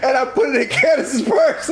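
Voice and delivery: confessing with laughter; laughing. Real, not acted. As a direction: laugh while you confess.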